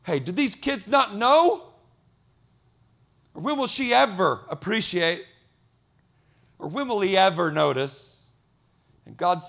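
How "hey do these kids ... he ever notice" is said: mildly hammed-up aggrieved complaint; acted exasperation. Parent griping that nobody notices, acted exasperation. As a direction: complain like someone taken for granted. Acts out unappreciated parent or spouse.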